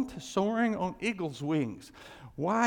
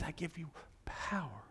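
near-whispered, low assurance; hushed divine promise; 3 semitones lower, 12 dB quieter than usual. Acted. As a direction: breathe it out softly, as God to the weary. Voices God granting power to weary.

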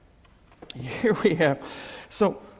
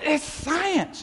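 mocking quote; 10 semitones higher than usual. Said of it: "I've even had".

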